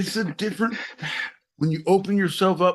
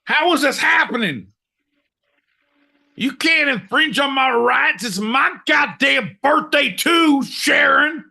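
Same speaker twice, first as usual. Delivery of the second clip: mock furious shout; 7 semitones higher than usual. Yelling at Sharon as police arrive, mock fury. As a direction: bellow it like a spoiled man-child.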